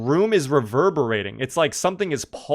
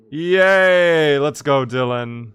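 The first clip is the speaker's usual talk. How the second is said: excited cheer; 6 dB louder, much slower than usual. Real, not acted. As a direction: cheer the win out loud. Cheering Dylan's race win.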